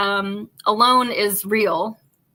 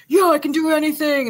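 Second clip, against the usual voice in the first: higher, louder macho boast imitated; cocky tough guy; 7 semitones higher, 4 dB louder than usual. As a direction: play the overconfident Navy seal. Cocky Navy seal quoted.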